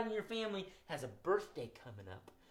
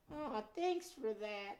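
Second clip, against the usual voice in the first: high, slow, timid critter voice; shy little muskrat voice, drawn out; high timid puppet muskrat; nervous puppet; 6 semitones higher, 7 dB quieter, slower than usual. Acted. Puppet Gus speaks.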